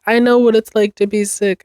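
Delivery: ordinary talk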